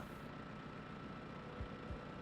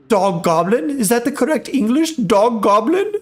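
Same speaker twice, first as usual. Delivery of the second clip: higher, hammed-up German accent; cartoonish German accent, pitched up; 5 semitones higher than usual. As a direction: put on a lilting mock German voice. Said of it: "German accent".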